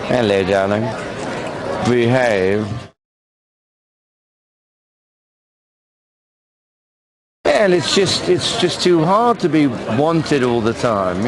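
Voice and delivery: put-on camp sixties London accent; Austin Powers voice.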